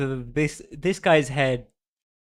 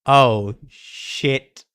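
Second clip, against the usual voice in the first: shocked outburst; 10 dB louder than usual. Real, not acted. Shocked reaction to the reveal.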